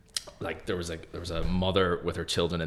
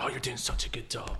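higher, softer mock gushing; American positivity; 4 semitones higher, 7 dB quieter than usual. Contrasting how Americans praise people, gushing mildly. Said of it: "they're so positive".

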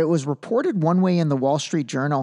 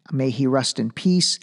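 normal talking voice

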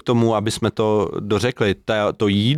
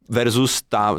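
usual speaking voice

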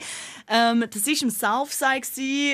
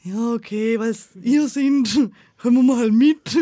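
reluctant; 5 dB louder, slower than usual. Retold or imitated. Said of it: "so widerwillig".

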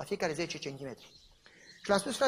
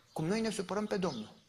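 ordinary delivery

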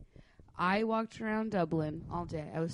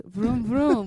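vroom engine noise; 4 semitones higher, 7 dB louder than usual. Retold or imitated. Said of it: "he goes".

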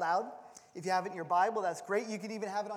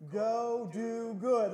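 slow, drawn-out divine command; mildly voiced God, slow; much slower than usual. Shown as a demonstration. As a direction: model the reply slowly, as God. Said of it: "you will always respond with the words".

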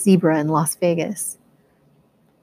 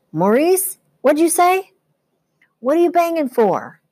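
much higher, louder shout from outside; shouting back; 11 semitones higher, 5 dB louder than usual. A narrator's reading. Eddie shouts back from outside the trailer, high and loud. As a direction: yell back through the wall, annoyed. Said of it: "shouted back".

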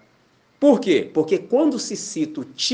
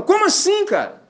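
much higher, loud disbelief; loud shocked question; 4 dB louder than usual. Retold or imitated. Stranger loudly asking how he can be a pastor, shocked. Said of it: "E falando altão".

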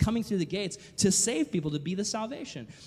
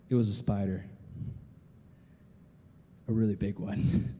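sheepish man's answer; 9 semitones lower than usual. Retold or imitated. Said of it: "And the guy responds".

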